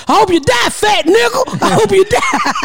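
high-pitched mock yell; retold screaming. Mother mimics coworkers screaming out the window, mock yell. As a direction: call it out like heckling from a window. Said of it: "they were screaming out the window".

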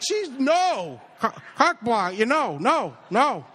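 high, stammering cry of objection; hammed-up teenage mortification; panicked protest. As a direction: frantically shut dad down, acting it out. Retold panicked objection to dad's teasing.

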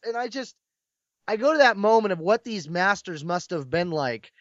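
normal talking voice

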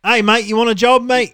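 loud, high Aussie-cockney mix; Australian accent. Acted. Podcaster plays employer offering jobs, mock Australian accent. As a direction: pitch up a hammed-up Australian accent. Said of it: "You sound like you're from London".

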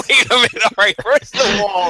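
laughing through the words, high and loud; cracking up as he talks. Real, not acted. Laughing at the brothers' dark gnome threats.

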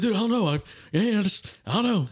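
high, flustered excuse; imitated freaked-out friend. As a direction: stammer defensively, as a rattled friend. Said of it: "Freaked out a little bit".

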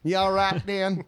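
high, loud mock Southern charm; hammed-up Southern drawl. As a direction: friend jokes about a Southern pickup line, hammed-up Southern accent. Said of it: "you gotta go Southern with it".

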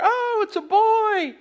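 hammed-up thrilled parent; family cheering the blue smoke; gender-reveal delight. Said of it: "they're like".

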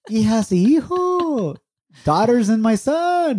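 high, drawn-out hearty greeting; big singsong welcome, pitched well up; father's greeting. Retold or imitated. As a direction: imitate Dad hailing the children. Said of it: "He'd be like".

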